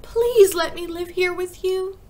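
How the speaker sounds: high, loud, drawn-out begging; slow, high plea; pleading cry. A narrator's reading. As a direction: implore as a desperate child. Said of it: "Matilda cried".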